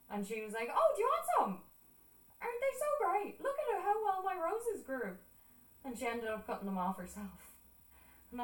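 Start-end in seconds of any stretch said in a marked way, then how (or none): 0.7-5.2 very high, gushing mimicry of an aunt; high-pitched, delighted offer; excited aunt imitation. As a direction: proudly show off, pitched way up. Aunt proudly offering her roses.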